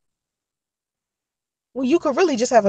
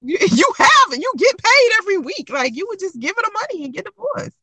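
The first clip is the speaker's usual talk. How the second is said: incredulous scolding; 8 semitones higher than usual. Retold or imitated. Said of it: "I was looking at him like".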